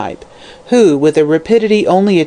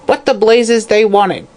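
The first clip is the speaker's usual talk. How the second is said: put-on slow, high old hag's demand; a crone snapping a question; 6 semitones higher than usual. Old hag demanding of her visitors, slow and high.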